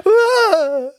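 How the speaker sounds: high shrieking yelp of fright; sudden scared scream; startled yelp. Retold or imitated. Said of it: "And you were like".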